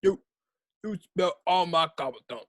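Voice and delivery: shaky attempt at Cockney; Cockney accent. Shown as a demonstration. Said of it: "I can't do that".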